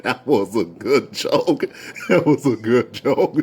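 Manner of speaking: slow words broken by chuckles; imitated laughing. Rapper praising the joke, still laughing. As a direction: mimic him talking through laughter. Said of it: "This man was just laughing his butt off".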